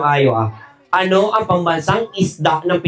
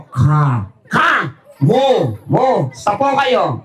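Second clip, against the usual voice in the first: slow syllable by syllable; much slower than usual. Shown as a demonstration. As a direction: spell "Ka, ka, mo, mo" out slowly, syllable by syllable. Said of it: "gayahin mo ko".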